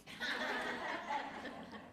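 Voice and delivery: breathy high giggle; high airy laugh. Real, not acted. Coach laughing at the client's awkward love you. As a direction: burst into a light laugh.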